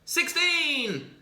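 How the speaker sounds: high, loud chanted yell; repeated high excited shouts of a boy jumping; kid yelling. Acted. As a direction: yell it out gleefully like a bouncing kid. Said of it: "he's yelling".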